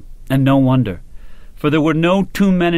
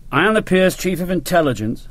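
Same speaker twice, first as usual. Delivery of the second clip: higher-pitched, grand colonel; 4 semitones higher than usual. A narrator's reading. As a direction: declare it grandly, full of self-importance. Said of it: "Hogan said grandly".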